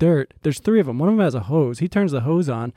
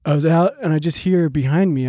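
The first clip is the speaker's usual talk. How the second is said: ordinary delivery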